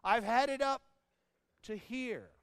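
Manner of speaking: mock exasperation; fed-up prophet. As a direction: burst out like a worn-out parent. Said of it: "He said".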